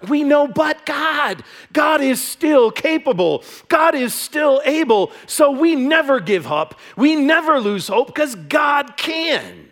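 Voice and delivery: rising, emphatic preaching, slow and high. Acted. Pastor proclaiming to the church, slow soaring preaching. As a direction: proclaim it slowly, lifting the room. Preacher declares God is able.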